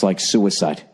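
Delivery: ordinary talk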